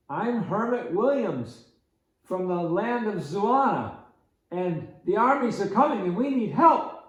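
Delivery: an otherworldly hermit urgently repeating his plea; strange hermit voice. Retold or imitated. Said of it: "why is he talking like that?".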